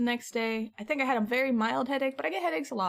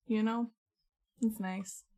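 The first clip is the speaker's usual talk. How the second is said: ordinary talk, nothing marked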